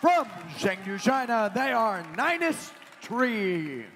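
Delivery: loud, drawn-out team introduction; arena announcer. Real, not acted. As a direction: boom out the team name for the crowd.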